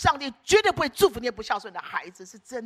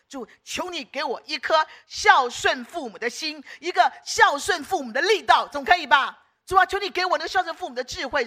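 loud, raised-pitch earnest plea; pleading prayer; 4 semitones higher, 7 dB louder than usual. Shown as a demonstration. Believer begging the Lord for filial wisdom, pleading loudly. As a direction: beg God insistently, hitting each request. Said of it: "你可不可以这样祷告啊".

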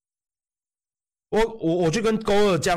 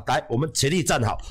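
ordinary talk, nothing marked

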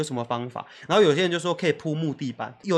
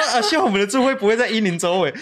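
mock plea with a laugh; 5 semitones higher, 5 dB louder than usual. 祈祷座位不在婴灵旁.

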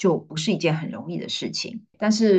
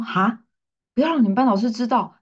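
incredulous retold question; 7 semitones higher, 4 dB louder, faster than usual. Teacher hears unexpected request.

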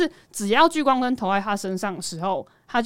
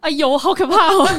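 laughing; 8 semitones higher, 9 dB louder than usual. Real, not acted. Teasing about the serious topic.

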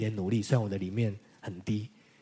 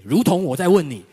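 booming, much louder and higher declaration; God's emphatic word; 7 semitones higher, 12 dB louder than usual. Retold or imitated. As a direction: proclaim it as God's own voice, full force. Quoting God's revelation. (宣告上帝的话，洪亮有力)

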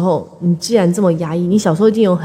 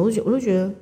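usual speaking voice

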